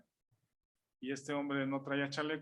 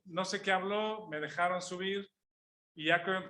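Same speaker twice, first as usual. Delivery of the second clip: normal talking voice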